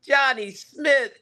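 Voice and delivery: mock play-by-play call. Young sportscaster calling a high school touchdown, excited slow call. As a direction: stretch it out like a rising stadium call. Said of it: "And I go".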